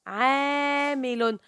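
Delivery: "عامل" with the alif held very long; slow, sustained long a in "عامل"; long vowel. Shown as a demonstration. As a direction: stretch the first syllable of "عامل". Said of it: "نطقت العين مع الألف".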